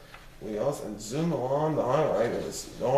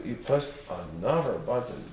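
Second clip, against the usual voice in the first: ordinary delivery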